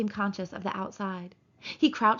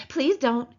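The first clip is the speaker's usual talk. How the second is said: louder pleading Lenny; begging; 4 semitones higher, 6 dB louder than usual. Acted. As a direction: beg her to stop. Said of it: "he begged".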